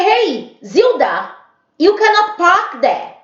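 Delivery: high, loud mock yell of the neighbor; shrill-pitched imitation of an angry neighbor; shouting neighbor.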